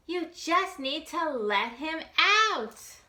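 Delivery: gentle reassurance to a scared friend; encouraging. A narrator's reading. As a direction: coax him kindly. Little Bob encouraging friend.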